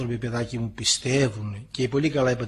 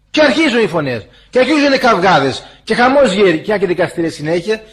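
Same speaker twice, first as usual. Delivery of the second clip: ordinary talk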